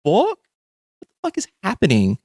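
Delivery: high, stunned what the fuck; shocked disbelief. Retold or imitated. Reacting to a TV clip, stunned and incredulous. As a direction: jumps way up in disbelief, then rushes.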